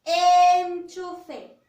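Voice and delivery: Enchufe said loud, with the E held; loud teacher model of Enchufe; emphasized model word. Shown as a demonstration. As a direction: make the child hear the E of Enchufe.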